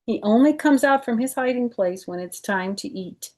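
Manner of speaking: lively read-aloud storytelling voice; children's book narration; story narration. Acted. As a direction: read it to kids, bright and clear. Reads picture book aloud.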